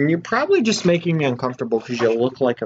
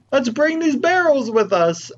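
sing-song, much higher; cheerful high voice; 13 semitones higher, 5 dB louder than usual. Retold or imitated. Recalling his early playthrough plan, in a high chirpy voice. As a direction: chirp it brightly like a hopeful past self. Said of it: "I was like".

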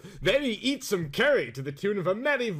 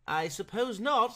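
higher, slow, mildly interested; 7 semitones higher than usual. Acted. Visitor answering the groom, mildly interested. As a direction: draw it out with curiosity. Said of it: "in an interested tone".

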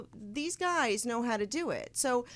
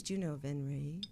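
low, hushed imitation of a shy musician; soft-spoken; 7 semitones lower, 6 dB quieter than usual. Host mimics musician leaning in close, soft-spoken. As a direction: mimic a man who talks very softly. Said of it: "he talks so soft".

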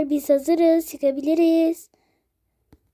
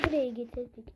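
whispered aside; 3 semitones lower, 12 dB quieter, faster than usual. Acted. Fısıltıyla söylenen.

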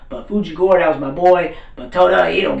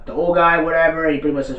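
ordinary delivery

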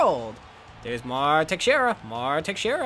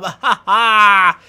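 ordinary talk

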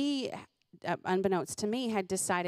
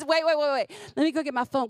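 gleeful urgency; 6 semitones higher, 6 dB louder, faster than usual. Retold or imitated.

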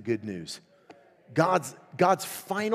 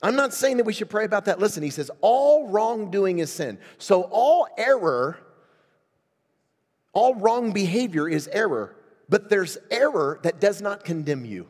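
full-force preaching; 8 semitones higher, 7 dB louder than usual. Acted. Pastor preaching to his congregation, higher and louder. Opening point preached loudly.

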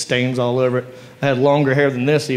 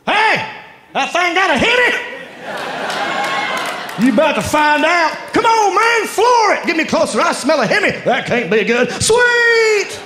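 reenacted truck ad lines; 13 semitones higher than usual. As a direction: belt out every ad line like a montage. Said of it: "I'm gonna reenact all of them for you".

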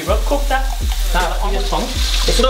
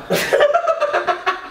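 sudden high giggling laugh; high burst of laughter. Real, not acted.

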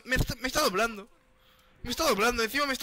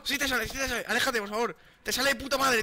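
ordinary talk

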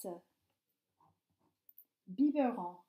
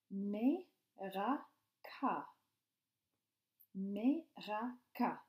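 sounding out syllables; slower than usual. Shown as a demonstration. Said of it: "Écris chaque mot en mettant ... les syllabes en ordre".

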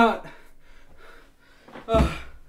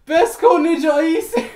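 ordinary talk

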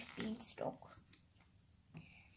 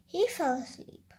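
ordinary delivery, nothing marked

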